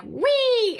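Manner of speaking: very high squeal of joy; like riding a zip line; gleeful whee. Retold or imitated. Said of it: "And I'm like".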